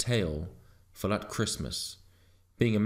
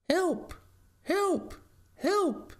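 very high-pitched panicked dog; crying for help; much slower than usual. A narrator's reading. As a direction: squeal for help in short separate cries. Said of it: "he cried outside the back door".

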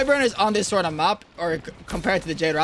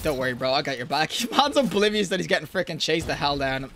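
usual speaking voice

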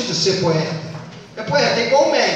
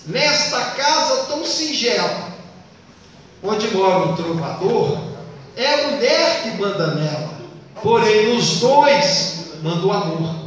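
poem recitation. Acted. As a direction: recite the verse deliberately, line by line. Recites a trova.

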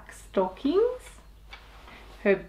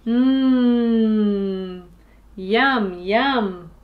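mmm yum; 2 semitones lower, 4 dB louder, much slower than usual. Acted. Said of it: "said Meg".

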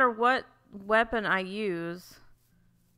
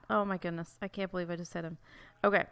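normal talking voice

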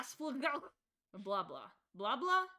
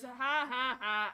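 deadpan ha ha; 5 dB louder, slower than usual. Real, not acted. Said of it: "I get it".

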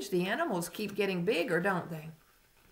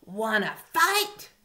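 grouchy ladybug; 4 semitones higher, 6 dB louder, slower than usual. A narrator's reading. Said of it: "said the grouchy ladybug".

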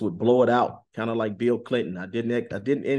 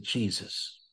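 soft inward Jesus; silent inner call; 8 dB quieter than usual. Retold or imitated. Calling on Jesus silently against the demon, hushed. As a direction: breathe the name quietly, as if only in the mind. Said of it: "just in my conscience, I called on Jesus".

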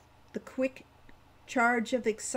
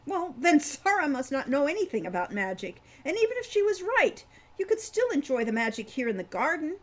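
put-on higher and louder, the girl jumping to his defence; indignant child Wren; 7 semitones higher, 6 dB louder than usual. A narrator's reading. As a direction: stick up for him, voice rising high on even if.

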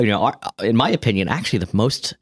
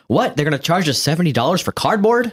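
mock incredulity; 8 semitones higher than usual. Voicing the public's shock at price.

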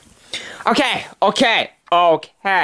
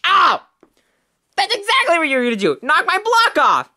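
high-pitched pained protest; acted high, aggrieved exclaiming; indignant outcry; 8 semitones higher than usual. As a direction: yelp it out high and outraged. Hit after taunting, protests.

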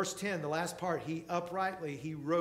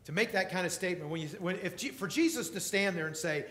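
ordinary delivery

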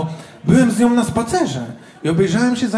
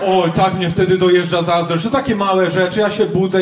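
ordinary talk

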